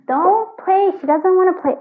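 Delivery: high, loud telling-off of a cat; high sharp scold at the naughty cat. Real, not acted. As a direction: call out to stop the cats. Scolding her cats mid-reading.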